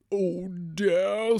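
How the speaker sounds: put-on a tired old hound sighing; old dog voice.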